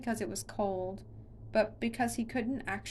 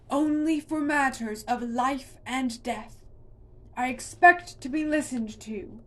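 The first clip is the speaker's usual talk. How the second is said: stern monster; 4 semitones higher, 8 dB louder than usual. A narrator's reading.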